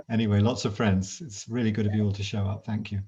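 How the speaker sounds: usual speaking voice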